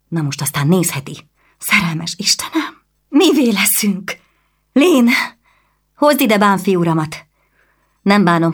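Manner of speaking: a noblewoman in a rage; angry noblewoman. Acted.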